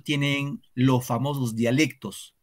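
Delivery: usual speaking voice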